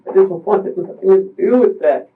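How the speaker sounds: high, strained voice close to crying; pleading through tears; tearful. Real, not acted. Explaining to the investigator why he couldn't leave, tearful. Breaking down over the fall.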